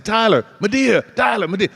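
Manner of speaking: high, singled-out name calls; imitated fan calls. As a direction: mimic fans calling out to a star. Imitating fans calling on the street.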